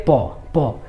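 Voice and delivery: प made by closing both lips; isolated प, repeated as a lip-closing demonstration; labial sound demonstrated. Shown as a demonstration. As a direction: press the lips together for each प. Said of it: "दोनों ओठ जो है एक दूसरे से सट".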